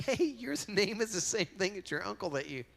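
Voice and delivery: animated, high-pitched quote; excited retelling. Retold or imitated. Acting out Walter conversation, excited.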